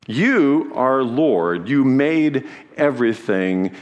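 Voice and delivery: slow, weighty proclamation; emphatic praise. Acted. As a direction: lay down each word slowly. Sermon climax, paraphrased praise.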